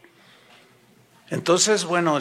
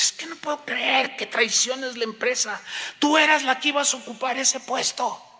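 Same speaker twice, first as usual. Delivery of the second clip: higher-pitched put-on indignation; mock outrage; 8 semitones higher than usual. Coworker accusing someone of betraying the company, mock outraged. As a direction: act scandalized by the betrayal.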